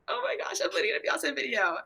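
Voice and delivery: high squeal, no real volume; mock excited scream. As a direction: imitate screaming in a small high voice. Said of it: "I was screaming".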